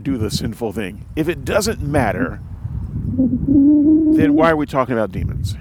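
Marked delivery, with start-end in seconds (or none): 2.9-5.6 high, loud exasperated cry. Real, not acted. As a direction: throw the question up in exasperation. Exasperated rhetorical question.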